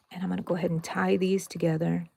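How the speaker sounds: whispered while concentrating. Real, not acted. To viewers while tying a knot, whispered. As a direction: breathe it out under your breath.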